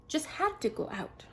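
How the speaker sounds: plain reading voice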